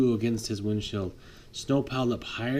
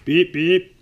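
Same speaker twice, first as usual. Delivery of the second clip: loud high happy beeps; horn beeps; 7 semitones higher, 8 dB louder than usual. Acted. Little snowplow blowing his horn in triumph, happy beeps. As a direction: toot the horn proudly.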